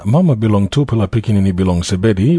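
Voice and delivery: usual speaking voice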